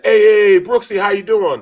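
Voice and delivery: loud, high, hearty hey hey hey; mock coach hollering a cheery hello; booming coach greeting. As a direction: imitate a big-voiced coach calling a player. Impersonating coach calling on phone.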